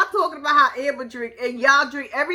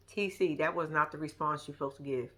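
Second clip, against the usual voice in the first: low scolding; 9 semitones lower, 11 dB quieter than usual. Retold or imitated. Telling TC his answer was wrong, low soft scolding. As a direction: lower and much softer, disapproving.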